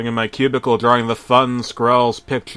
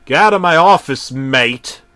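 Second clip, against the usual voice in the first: loud, higher mock-Aussie yell; yelled at the tape; 4 semitones higher, 10 dB louder than usual. Narrator yelling ironically at the VHS tape. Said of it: "I yelled at the V H S tape".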